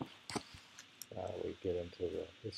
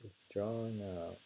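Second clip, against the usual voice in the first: usual speaking voice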